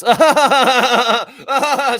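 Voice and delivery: loud, high mocking cackle; shrill mock laugh at Scotty. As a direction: cackle like the smug reporter.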